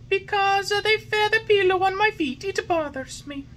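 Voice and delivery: put-on high, loud whiny boy voice; stubborn puppet. A narrator's reading. Puppet refusing the fairy's medicine. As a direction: refuse like a spoilt child.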